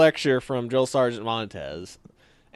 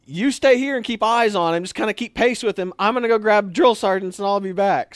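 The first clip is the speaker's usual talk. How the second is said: calling out; 7 semitones higher, 5 dB louder than usual. Retold or imitated. Shouting orders mid-chase.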